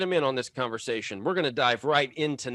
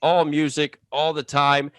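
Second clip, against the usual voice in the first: podcast host intro; 5 dB louder than usual. Acted.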